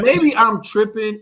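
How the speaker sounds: high, loud and slow, can't believe it; stunned disbelief. Real, not acted. Host to viewers, stunned by what he sees on video. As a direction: draw it out in shock.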